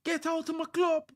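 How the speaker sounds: high, put-on furious fan; hammed-up fan rant; angry fan voice. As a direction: yell it as a mock enraged owner.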